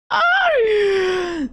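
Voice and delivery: high joyful scream; excited shriek. Real, not acted. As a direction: let out a happy shriek.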